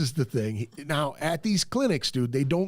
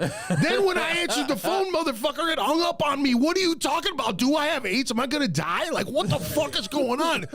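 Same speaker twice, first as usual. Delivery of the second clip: a furious, scared patient shouting down the phone; panicked yelling; 10 semitones higher than usual. Retold or imitated. Patient yelling at the doctor who hung up, high and frantic. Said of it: "I went".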